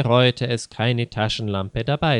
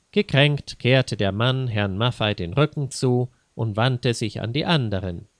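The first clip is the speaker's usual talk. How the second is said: ordinary delivery, nothing marked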